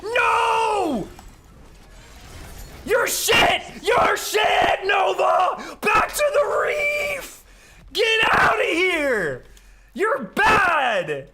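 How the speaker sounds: high, loud furious shouting at an enemy; excited yelling. Real, not acted. Gamer screaming at rival player Nova to get out. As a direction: scream him off the map, full rage. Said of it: "I got a little excited there".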